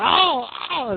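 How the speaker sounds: high mock pained cries; Shawn Michaels screaming. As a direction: cry out in pain, hammed up. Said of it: "That's my Shawn Michaels screaming impression".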